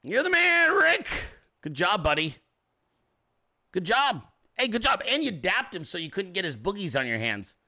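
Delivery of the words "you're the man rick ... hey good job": put-on high-pitched adulation; fake-sincere trolling. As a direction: mimic the heckler's gushing praise. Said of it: "And you're sincere as fuck".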